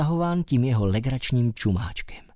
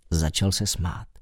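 plain reading voice

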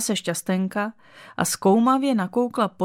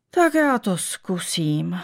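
resigned; slower than usual. A narrator's reading. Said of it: "řekla odevzdaně".

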